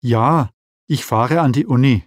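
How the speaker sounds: normal talking voice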